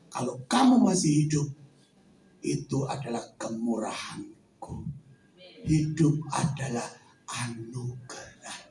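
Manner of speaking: the Lord speaking low and soft to Vincent; voice of God. Acted. God's voice told in a testimony.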